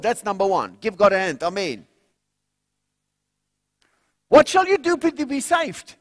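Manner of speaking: loud, high rallying preacher cry; preaching at full force. Acted. Preacher to congregation about being saved, loud rousing preaching. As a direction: rouse the congregation to call on the Lord.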